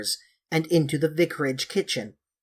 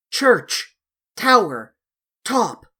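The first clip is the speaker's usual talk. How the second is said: deepest voice, slow and loud; 5 semitones higher, 5 dB louder, slower than usual. Acted. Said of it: "in his deepest voice, very slow and loud".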